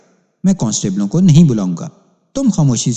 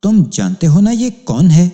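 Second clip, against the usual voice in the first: pressing question; 7 semitones higher, 5 dB louder than usual. A narrator's reading. Policeman pressing the suspect.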